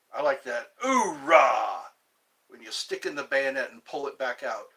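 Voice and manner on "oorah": barked Marine battle cry; loud gung-ho soldier. Acted. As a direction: shout it like a drill sergeant. Marine Corps cheer, repeated.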